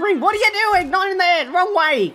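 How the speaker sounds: frantic heckle; exasperated coaxing. Real, not acted. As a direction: scold the screen in rising frustration. Yelling at the game wrestler.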